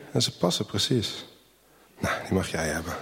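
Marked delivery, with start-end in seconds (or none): 2.0-2.9 put-on softly, gently to the baby. A narrator's reading. Old shoemaker softly gives the baby the shoes. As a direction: hush it down tenderly. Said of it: "zegt hij zacht".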